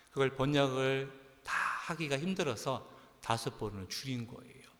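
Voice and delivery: ordinary talk, nothing marked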